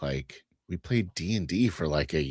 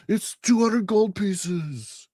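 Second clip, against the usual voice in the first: slow, high nerdy game master; pompous game master voice; much slower than usual. Retold or imitated. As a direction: announce the price like a stiff game master. Game master voiced in pizza story.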